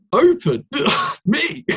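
high, incredulous re-enactment with laughter; hammed-up disbelief, pitch leaping up; mock astonishment. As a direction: squeak it as if the idea is absurd. Acts out own disbelief at question.

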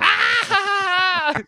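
high, drawn-out "ah"; hammed-up high-pitched yell; mock crowd scream. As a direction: shriek like a thrilled audience. Imitates crowd reacting to the bit.

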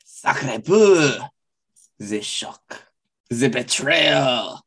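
slow, hammed-up French gasp of betrayal; mock French outrage. Friend mock-outraged at a prank, hammed-up French drama.

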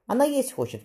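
mild narrated call, pitched high. A narrator's reading. Child calls to her mother about the hungry baby, mildly calling out. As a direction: call out high without really shouting. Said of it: "крикнула с плаща старшая Мириам".

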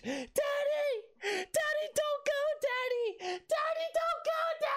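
put-on high, childlike cry of Daddy, don't go; a small daughter begging her father to stay; little girl pleading. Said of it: "his little daughter".

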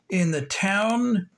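usual speaking voice

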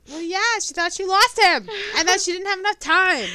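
very high, loud mock wail; mock tearful defence. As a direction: plead your case in an exaggerated weepy shout. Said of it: "Jackson is crying".

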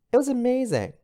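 high, loud burst of delight; gushing. Real, not acted. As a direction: gush about the movie. Gushing about the movie.

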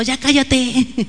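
drawn-out mock reprimand; mock scolding. Disciples hush the blind man shouting to Jesus, mock scolding. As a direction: scold him slowly, in play. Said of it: "lo estaban reprendiendo".

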